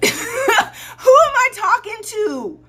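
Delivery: high, slow laughing question. Real, not acted. Coach laughing at her own rant, high laugh. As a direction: laugh it out in disbelief.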